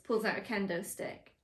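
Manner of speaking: ordinary delivery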